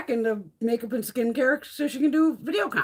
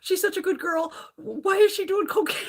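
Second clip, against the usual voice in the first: higher-pitched imitation of alarmed coworkers; mock freaked-out; 7 semitones higher than usual. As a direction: voice their worried gossip, pitched well up. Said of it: "you got 'em all freaked out".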